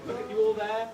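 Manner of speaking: normal talking voice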